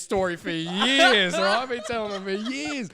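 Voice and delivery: high, loud, worked-up disbelief; incredulous outburst. Real, not acted. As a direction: shout it out, unable to believe it. Relives disbelief at the revelation.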